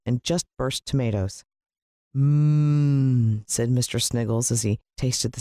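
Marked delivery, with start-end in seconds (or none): 2.2-3.4 loud, drawn-out yummy hum; savouring mmm. A narrator's reading. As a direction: hum with delight at the taste. Tasting the sauce.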